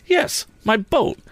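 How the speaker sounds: slow, high-pitched, emphatic; cross and drawn out; angry insistence. A narrator's reading. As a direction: hammer it home indignantly. Tom insists angrily on his accusation.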